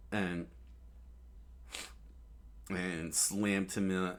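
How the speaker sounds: usual speaking voice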